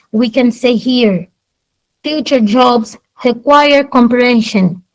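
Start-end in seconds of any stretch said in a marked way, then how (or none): none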